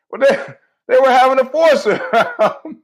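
high, loud, through laughter; real laughter breaking into the words; laughing. Real, not acted. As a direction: crack up while saying it. Cracks up at own punchline.

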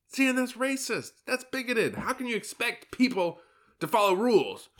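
high mocking scolding voice. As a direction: squeak out a prissy scold of rules.

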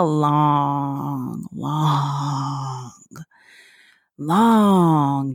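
long long drawn out slowly; stretched out. Real, not acted. Mom recalling breastfeeding months, stretching it slowly. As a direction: drag out the long, long wait.

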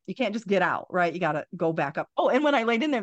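usual speaking voice

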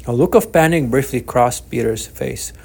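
plain reading voice

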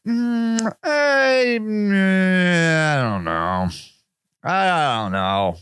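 doubtful shrugging hesitation; unconvinced. Retold or imitated.

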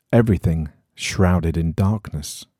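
usual speaking voice

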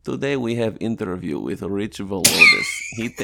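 loud hammed-up Russian accent; mock Russian accent. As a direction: announce it like a joking Russian host. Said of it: "do it in a Russian accent".

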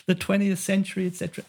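aghast economists. Retold or imitated. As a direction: imitate scandalised colleagues objecting. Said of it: "all my economist, like".

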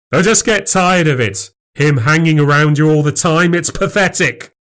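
high, exasperated complaint; fed-up anger. Acted. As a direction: let out jealous irritation. Husband quarrels with wife.

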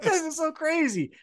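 excited bros. Retold or imitated. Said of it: "they're like".

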